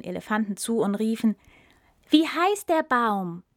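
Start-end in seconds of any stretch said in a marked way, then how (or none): 2.1-3.4 desperate crowd cry. Acted. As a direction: shout the question at him. Animals run up shouting.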